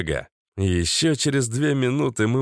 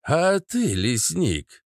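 croaking; 7 semitones higher, much slower than usual. A narrator's reading. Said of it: "прокаркал папаша Кац".

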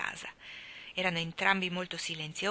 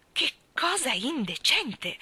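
slow drawn-out moan of outrage; wailing indignation, dragged slowly; 6 semitones higher, 3 dB louder, slower than usual. Acted. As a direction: groan the words as the door shuts. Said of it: "la sentirono gemere".

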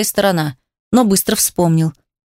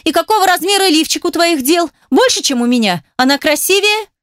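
high-pitched taunting questions; biting, rising interrogation; jealous sarcasm; 8 semitones higher than usual. A narrator's reading. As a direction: needle him with mock curiosity.

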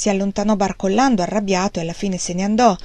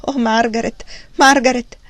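anguished cry; 3 semitones higher, 4 dB louder than usual. A narrator's reading. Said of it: "con grande dolore".

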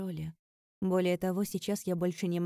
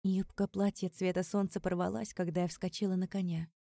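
plain reading voice

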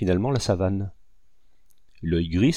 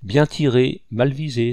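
raised, jeering call; sneering exclamation; 4 semitones higher, 5 dB louder than usual. A narrator's reading. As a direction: crow mockingly at the missed shot. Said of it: "s'écria le chasseur avec son éternel ricanement". (exclamation ricanante, moqueuse)

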